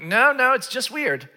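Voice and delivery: high, loud protest; comic loud contradiction; emphatic objection. Acted. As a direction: burst out in disagreement. Objecting to the pious reading.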